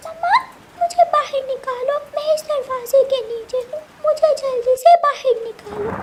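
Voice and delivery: put-on high, pleading child under the door; small child voice. Trapped chick begging mother to pull him out, high child voice. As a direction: beg to be pulled out like a little kid.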